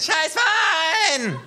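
long, very high, loud yell; kangaroo scream. Acted. Kangaroo joining in, drawn-out scream. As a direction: scream it out, stretching the word. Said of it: "sagt das Känguru nickend und schreit".

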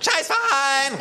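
long, very high, loud yell; kangaroo scream. Acted. Kangaroo joining in, drawn-out scream. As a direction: scream it out, stretching the word. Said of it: "ruft das Känguru".

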